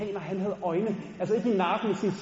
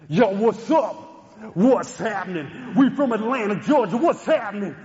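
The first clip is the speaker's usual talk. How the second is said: loud hyped rapper stage greeting; loud American rapper; 3 semitones higher, 6 dB louder than usual. Retold or imitated. American rappers greeting the concert crowd, loud and hyped. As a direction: hype the crowd like a star.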